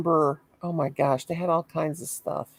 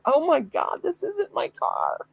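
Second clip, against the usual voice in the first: much higher, slow horrified gasp; shocked realization; 10 semitones higher, slower than usual. Retold or imitated. Mom realizing in front of the store she's in a stranger's car, shocked. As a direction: realize it isn't your car. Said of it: "and I went".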